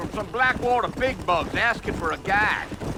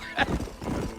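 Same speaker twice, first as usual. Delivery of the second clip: short breathy laugh; a guide laughing off his complaint; wheezy chuckle; 6 dB quieter than usual. Acted. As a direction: chuckle at your own gripe. Guide laughs at coyote joke.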